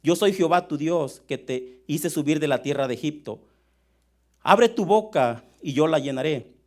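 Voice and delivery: loud, deliberate scripture reading; lectura bíblica solemne. Acted. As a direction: proclaim the psalm to the congregation, loudly. (lectura del salmo, fuerte y pausada)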